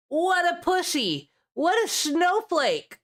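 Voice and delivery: high-pitched exaggerated sneer at a softie; hammed-up scornful taunt; mock someone in a high, scoffing voice; mocking jeer. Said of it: "It's just like".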